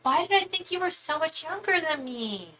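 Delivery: normal talking voice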